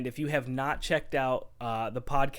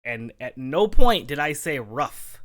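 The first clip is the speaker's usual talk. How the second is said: indignant; 11 dB louder than usual. Real, not acted. Indignant defense of his pronunciation.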